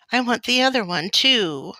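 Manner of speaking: put-on high, loud childish wail; acted little-girl tantrum sob; loud sobbing. A narrator's reading. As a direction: wail your demand in tears. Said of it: "Laura sobbed loudly".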